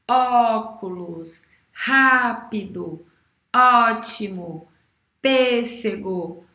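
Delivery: each word drawn out slowly and loud; teacher pacing a slow word list; slow read-along list. Shown as a demonstration. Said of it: "a gente vai ler as palavras juntas".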